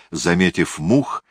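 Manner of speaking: ordinary delivery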